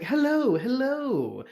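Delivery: high lilting hello; sing-song madam. Acted. Madam greeting the party, high sing-song hello. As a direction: greet them in a lilting host's voice. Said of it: "uh, like".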